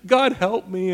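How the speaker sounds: mock tearful apology. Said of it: "Saul puts on this thing".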